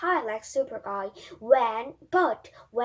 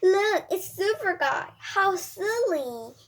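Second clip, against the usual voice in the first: a hint of teasing children, higher; mocking kids; 7 semitones higher, 4 dB louder than usual. A narrator's reading. Children teasing the boy in costume, mocking. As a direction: sneer like a playground bully. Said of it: "they laugh and said".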